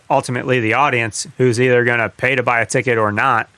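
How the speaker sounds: normal talking voice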